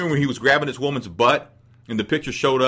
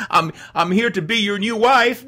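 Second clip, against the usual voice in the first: mock eager woman; 9 semitones higher than usual. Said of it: "You're just gonna show up".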